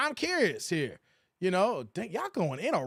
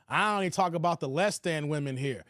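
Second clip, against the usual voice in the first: ordinary talk, nothing marked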